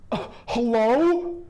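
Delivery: a put-upon viewer interrupted mid-show; mock exasperated answer to a call; annoyed hello. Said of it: "and they're like".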